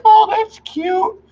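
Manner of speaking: an exaggerated high "aww" voice; mock sweet reaction. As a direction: coo it like a charmed viewer, hammed-up. Mock viewer reaction to bunny.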